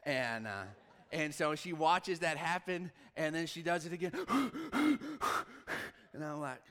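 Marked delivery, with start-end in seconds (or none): 4.2-5.9 loud high panicked gasp; sharp gasp. Retold or imitated. Dad retelling his daughter's panicked gasp again. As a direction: gasp like she can't breathe.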